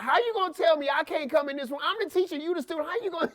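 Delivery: high, loud, indignant teacher protest; difficult adult. Retold or imitated. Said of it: "being a difficult and adult".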